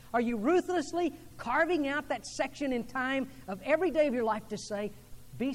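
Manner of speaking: fervent preacher's push; preaching at full force. Acted. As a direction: press every question home from the pulpit. Preaching the call to action.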